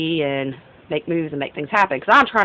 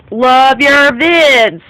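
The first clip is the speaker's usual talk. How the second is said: reading a text; 7 semitones higher, 8 dB louder, much slower than usual. Retold or imitated. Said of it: "Dave says".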